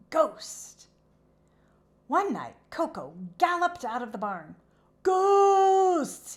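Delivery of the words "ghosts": high, loud panicked cry of ghosts; frightened horse shouting high and loud; scared cry. A narrator's reading. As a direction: yell it in terror, running out. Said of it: "he cried".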